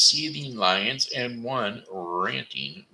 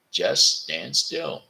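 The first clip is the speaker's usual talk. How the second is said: slow, calm; 8 semitones higher, slower than usual. Acted. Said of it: "he told the dragon".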